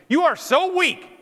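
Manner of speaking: high, loud, drawn-out mock outburst; exploding husband. Husband leaping out of bed at his wife, exploding. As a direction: cry it out slowly in exasperation.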